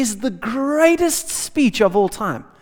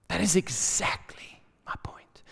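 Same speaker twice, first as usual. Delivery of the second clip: whispered point; 3 semitones lower, 14 dB quieter than usual. Real, not acted. Repeating his point softly.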